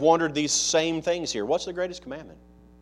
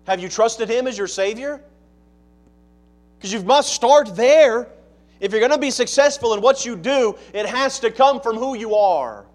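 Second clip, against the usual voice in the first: loud, higher-pitched sermon delivery; forceful preaching; 9 semitones higher, 10 dB louder than usual. Real, not acted. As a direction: press the congregation hard with each phrase.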